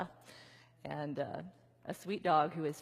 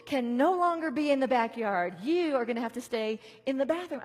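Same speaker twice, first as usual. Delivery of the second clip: mock stern owner; 5 semitones higher, 7 dB louder than usual. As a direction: lay down the law to the dog. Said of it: "And I'm like".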